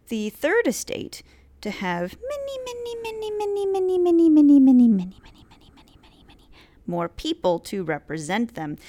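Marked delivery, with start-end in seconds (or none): 2.2-5.7 way higher, in a climbing put-on voice; mock exaggerated many. Teacher exaggerating the Third Estate's numbers, high and loud. 5.8-6.5 whispered, fading away; trailing whisper. Acted. Teacher trailing off the long list of manys in a whisper.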